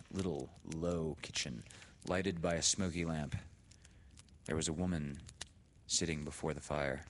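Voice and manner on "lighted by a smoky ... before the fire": plain reading voice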